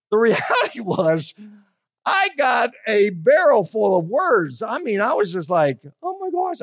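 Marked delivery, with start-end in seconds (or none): 6.0-6.6 astonished at the foul language; high, stunned oh my gosh; high astonished exclaim. Retold or imitated. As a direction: pitch leaps high in disbelief. Retelling his shock at her language.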